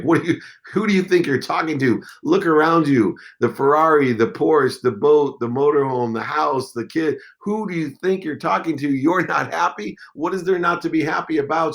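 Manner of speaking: high-pitched, offended retort, half-acted; mock self-righteous rant; indignant outburst. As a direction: replay the arrogant indignation with pitch up. Retelling his indignant outburst at wife.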